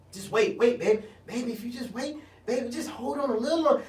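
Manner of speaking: a soft, coaxing mock of an elder telling him to wait; patronizing elder.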